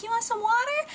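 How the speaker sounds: high sweet coaxing question; baby talk to kitten. Retold or imitated. Offering a thirsty kitten water from a cap, high baby talk. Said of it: "It was like".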